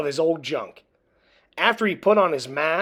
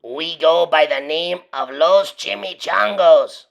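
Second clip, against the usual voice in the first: growling; 7 dB louder than usual. A narrator's reading. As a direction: growl it like a tough leader. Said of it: "growled Don Diego".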